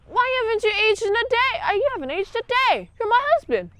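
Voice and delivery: put-on high, insistent old wife voice; imitating a pleading wife. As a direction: plead up high with her lost husband. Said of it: "she's like".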